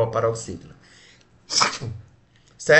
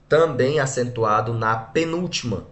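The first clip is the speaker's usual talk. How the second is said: usual speaking voice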